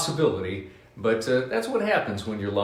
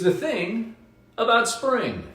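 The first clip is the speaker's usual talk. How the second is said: excited title; 12 semitones higher than usual. Shown as a demonstration. Title read out with a build.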